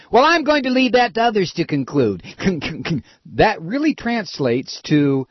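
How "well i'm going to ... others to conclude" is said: high-pitched mock politician; mocking politician's evasion. Host mocks Senator Clinton's non-answer, high mocking quote. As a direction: parrot the dodge high and with ridicule. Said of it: "it's gonna sound like".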